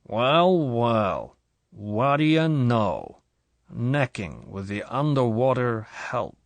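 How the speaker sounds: put-on slow, slurred drunk voice, each word separated; sneering drunk husband, dragged out; drunken slur. A narrator's reading. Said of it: "The speech had slurred".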